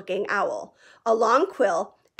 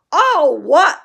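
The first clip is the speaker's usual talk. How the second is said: much higher and louder, a roar; thundered; 7 semitones higher, 11 dB louder than usual. A narrator's reading. Hagrid thundering Harry's question back at him. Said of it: "Hagrid thundered".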